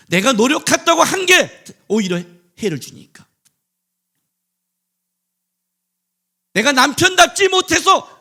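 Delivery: loud, high preaching; full-force sermon climax; fiery preaching. Acted. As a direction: thunder it from the pulpit.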